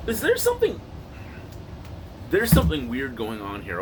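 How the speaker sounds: ordinary talk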